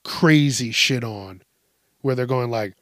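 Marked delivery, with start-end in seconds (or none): none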